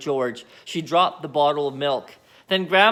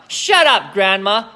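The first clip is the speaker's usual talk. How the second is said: snapping; 2 semitones higher, 6 dB louder, slower than usual. Acted. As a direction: tell her off sharply. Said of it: "he said".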